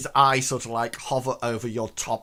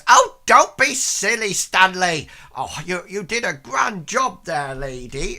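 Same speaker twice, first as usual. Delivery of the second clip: put-on high, loud old lady voice; old woman; 7 semitones higher, 7 dB louder than usual. Old woman modestly brushing off her husband's praise. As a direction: gush like a flattered old lady. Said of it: "And the woman says".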